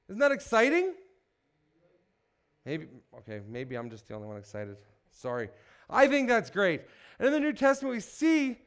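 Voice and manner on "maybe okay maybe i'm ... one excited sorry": sheepish aside. Real, not acted. Self-mocking aside after no response.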